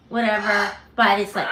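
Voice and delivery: ordinary talk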